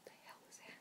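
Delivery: hushed, alarmed whisper; startled whisper. Real, not acted. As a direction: freeze and whisper at the sudden sound. Startled by a noise.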